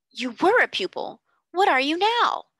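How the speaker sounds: urgent questioning. Acted. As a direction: press her for an answer, urgently. Carrisford pressing Sarah urgently.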